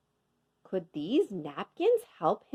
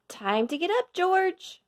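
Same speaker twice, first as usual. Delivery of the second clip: higher, bright wake-up call to George; man's cheerful call; 11 semitones higher than usual. A narrator's reading. Man wakes George, calling him up higher. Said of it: "he said".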